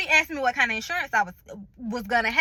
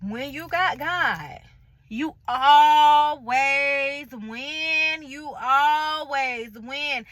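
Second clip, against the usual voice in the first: very slow, drawn-out testifying; testifying about God with very slow, drawn-out emphasis; testimony; much slower than usual. Real, not acted. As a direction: preach it slowly, savouring each word.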